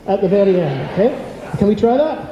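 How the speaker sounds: normal talking voice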